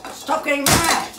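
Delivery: exasperated shout at a misbehaving ceiling fan; frustrated yell. Real, not acted. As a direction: snap at the wobbling fan to stop. Yelling at a spinning fan.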